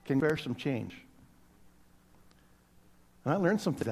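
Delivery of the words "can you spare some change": slightly slurred, soft beggar's plea. Retold or imitated. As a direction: mumble it, a little slurred. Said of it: "I said in a slightly slurred voice".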